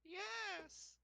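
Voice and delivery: high squeaky goblin yell. Acted. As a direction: shriek it like a goblin. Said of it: "yell back in a goblin voice".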